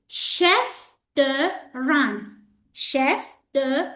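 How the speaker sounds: "chef de rang" slowly, word by word, "rang" like "run"; careful French pronunciation. Shown as a demonstration. Teacher models the supervisor's title for students, careful pronunciation. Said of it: "Be careful, it's not rang".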